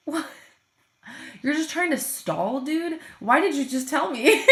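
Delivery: teacher stunned by her student's confession; incredulous. Retold or imitated. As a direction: react with loud, disbelieving surprise. Teacher hears student admits stalling.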